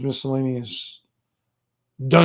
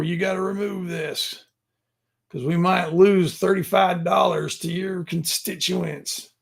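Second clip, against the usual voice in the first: Southern prison guard; 4 semitones higher than usual. Pretend. Guard ordering a zine maker out with hands up, mock Southern prison guard. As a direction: put on a Southern guard's accent, pitched up. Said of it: "sound like a Southern prison guard".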